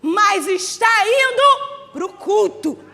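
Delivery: slow, drawn-out preaching refrain; slow fervent preaching cadence. Acted. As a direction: stretch every phrase for the congregation. Preaching refrain at full force.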